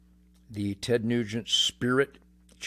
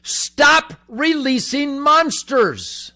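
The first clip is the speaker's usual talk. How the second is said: shout; 11 semitones higher, 9 dB louder, much slower than usual. Real, not acted. Opening shouted demand.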